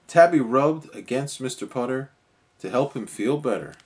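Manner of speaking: ordinary delivery, nothing marked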